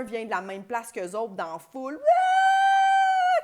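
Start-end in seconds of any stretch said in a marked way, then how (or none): 1.9-3.4 very high, loud whoop; wild crowd cheer. Retold or imitated. Cohost imitates Americans cheering a shared hometown, wild shriek. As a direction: scream like an overexcited fan in the stands. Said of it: "tu sais, comme ils capotent".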